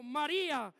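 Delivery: a strong, loud call of the name. Retold or imitated. Jesus calling Mary to make her recognize him, loud call. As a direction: call out to get her attention. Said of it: "Dios nos tiene que hablar fuerte".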